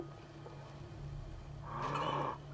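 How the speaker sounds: a breathy startled gasp in; sharp gasp. Real, not acted. Gamer startled by something on screen, gasps. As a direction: gasp in shock, high and airy.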